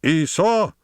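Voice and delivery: very high, commanding cry to a dog; imperious high-pitched call. A narrator's reading. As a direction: raise your voice like its master. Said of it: "in a masterfully raised voice".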